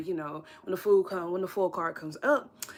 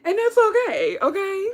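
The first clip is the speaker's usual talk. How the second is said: much higher, louder, bursting into laughter; gleeful reassurance ending in a high laugh; excited laugh; 13 semitones higher, 9 dB louder than usual. Real, not acted. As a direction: cheer them on until you crack up. Cheerful reassurance breaking into laughter.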